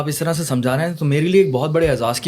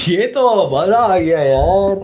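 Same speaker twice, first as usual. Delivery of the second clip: real thrilled outburst, raised and stretched out; delighted; 4 semitones higher, 4 dB louder, much slower than usual. Real, not acted. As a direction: draw it out in sheer delight. Delighted reaction to the app's demo. (خوشی سے جھوم کر، کھینچ کر)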